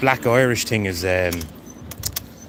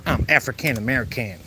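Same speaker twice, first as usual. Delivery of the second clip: higher-pitched mocking American accent; 4 semitones higher than usual. Streamer mimicking Americans naming themselves, mock American accent.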